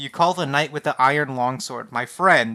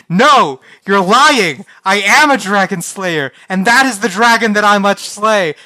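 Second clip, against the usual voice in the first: loud, higher, angry outburst; mad denial; 6 semitones higher, 12 dB louder than usual. Acted. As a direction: shout him down in full denial. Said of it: "so mad".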